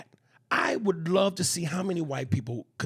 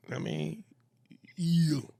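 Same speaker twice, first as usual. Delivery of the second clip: usual speaking voice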